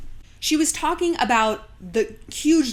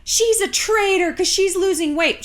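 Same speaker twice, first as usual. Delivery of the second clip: high-pitched mock outrage; raised, accusing mimicry; mock accusation; 8 semitones higher, 3 dB louder than usual. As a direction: imitate an indignant crowd condemning her. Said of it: "body positivity movement was like".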